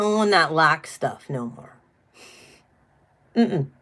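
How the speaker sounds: normal talking voice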